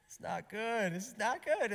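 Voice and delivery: high, rising squeal of dismay; mock dismay. Parent joking about first baby images, in high mock dismay.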